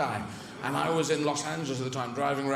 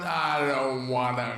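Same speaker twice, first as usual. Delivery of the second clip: long drawn-out whine; sulking Russia; 4 semitones lower, slower than usual. Acted. Russia backing out of the moon race, slow whine. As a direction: drag every word out slowly. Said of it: "And then Russia went".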